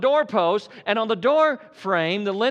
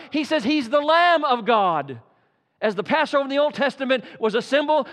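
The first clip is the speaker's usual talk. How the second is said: ordinary talk, nothing marked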